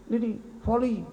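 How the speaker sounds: normal talking voice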